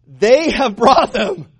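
hammed-up passing of blame; mock blame-shifting. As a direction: stretch it out and pin it on them. Plays Saul shifting the blame.

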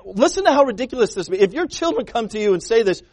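ordinary talk, nothing marked

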